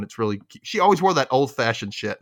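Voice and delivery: usual speaking voice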